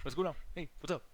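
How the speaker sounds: imitating a friend's quiet greeting; very soft, casual impression of a buddy; nineties. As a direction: drop the volume for the impression. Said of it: "this dude talks like this".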